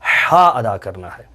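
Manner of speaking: ordinary delivery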